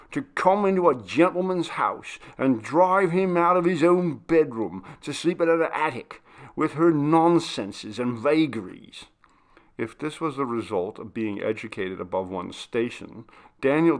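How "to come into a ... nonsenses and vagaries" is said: higher, scornful railing; mocking demand. The butler railing at Maria, scornful and loud. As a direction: jeer at her nonsense, then let it fall. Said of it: "demanded Daniel".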